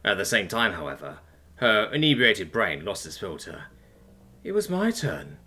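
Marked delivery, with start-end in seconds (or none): 4.4-5.4 high, plaintive bitter complaint. A narrator's reading. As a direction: let hurt slip out in a high voice. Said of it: "she heard herself say bitterly".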